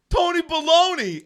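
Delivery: loud, high-pitched holler; calling out. Retold or imitated. Kid calls to a supposed friend on the courts, hollering. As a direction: shout a teasing nickname across the yard. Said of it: "I kept going".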